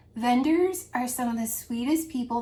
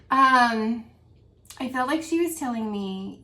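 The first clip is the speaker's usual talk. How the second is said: usual speaking voice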